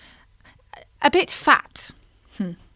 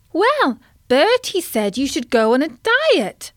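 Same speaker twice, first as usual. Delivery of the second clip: high squeaky tadpole voice; a small tadpole squeaking to the grumpy carp; 6 semitones higher, 4 dB louder than usual. Acted. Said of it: "squeaked Tim".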